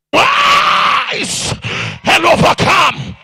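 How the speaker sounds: high, loud rising chant of rise; rousing preaching. Acted. Preacher calling the crowd to rise from shame, rousing shout. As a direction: build the call to the top.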